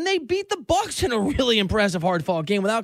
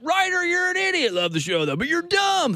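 mock fan voice; 8 semitones higher than usual. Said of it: "I remember getting a lot of".

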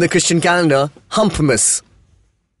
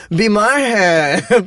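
very slow high plea; American Hindi accent; 6 semitones higher, much slower than usual. Retold or imitated. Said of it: "most American Hindi accent ever".

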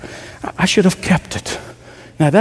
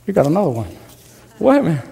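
usual speaking voice

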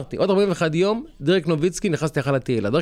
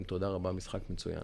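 hushed thanks; 6 semitones lower, 11 dB quieter than usual. Retold or imitated. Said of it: "אומר להם".

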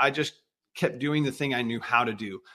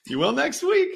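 mom's line replayed much higher, mock-stern; hammed-up mom order; 12 semitones higher than usual. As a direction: ham up mom's verdict for a laugh. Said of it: "And she goes".

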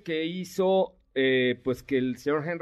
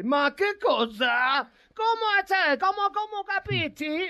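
mock Italian accent, pitched much higher and slowed; hammed-up Italian accent, drawing out every question; slower than usual. Host imitating an Italian advising Enzo Ferrari, hammed-up Italian accent. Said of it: "porque así hablan los italianos".

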